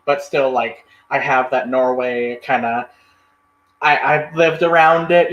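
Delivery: usual speaking voice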